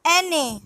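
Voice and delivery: N A run together as "EH-neh"; Spanish letter N. Shown as a demonstration. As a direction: say N A as one Spanish word, ene. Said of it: "N is pronounced as".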